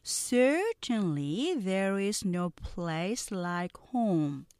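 very slow, carefully sounded English lines; slow model reading. Shown as a demonstration. Teacher reading slowly for students to repeat. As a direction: read each sentence slowly for repeating. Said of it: "느린 속도 듣고 따라해".

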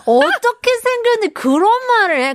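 high, riled-up retort to a friend; mock indignation. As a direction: act offended on behalf of a boyfriend. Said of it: "그런 느낌 있잖아요". (발끈해서 따지는 척)

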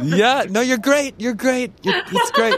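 high, laughing praise; laughing reassurance. Real, not acted. Host laughs to reassure the embarrassed guest. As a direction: reassure her through laughter. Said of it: "I didn't mean to put you on the spot".

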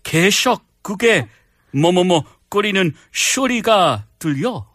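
put-on Korean in a slushy Scottish film-star accent; halting, put-on Scottish lilt; Sean Connery. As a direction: play the spouse as a suave spy. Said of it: "with the Sean Connery accent".

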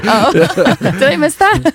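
giggling through the words; fighting back laughter; stifled giggles. Real, not acted. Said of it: "try not to laugh too much here".

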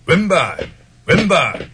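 barking gym coach. Acted. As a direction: bark out the left foot. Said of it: "응, 다시".

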